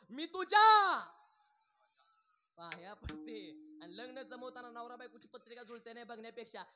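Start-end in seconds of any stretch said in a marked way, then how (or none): 0.1-3.5 loud burst, then a small plea; a mother-in-law begging her son's wife to stop fighting; pleading mother-in-law. Retold or imitated. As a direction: mock-desperate mother-in-law. Mother-in-law begs daughter-in-law.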